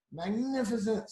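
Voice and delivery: high, gushing fantastic; mock amazed. Princess praising the dragon, high gushing. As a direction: gush over him. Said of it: "said Elizabeth".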